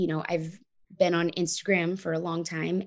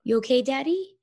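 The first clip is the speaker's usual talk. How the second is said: put-on higher, sweet childlike asking; a small child gently checking on her dad; mother voicing her three-year-old asking daddy if he's okay; little girl's voice; 7 semitones higher, 3 dB louder than usual. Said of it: "she'd put her hand on his cheek and be like".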